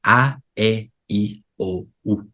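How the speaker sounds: "a, e, i, o, u" as pure Spanish vowels, one by one; fully pronounced vowels. Shown as a demonstration. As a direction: sound out "a, e, i, o, u" with a gap after each. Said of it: "you gotta pronounce the vowels".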